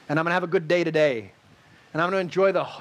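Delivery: usual speaking voice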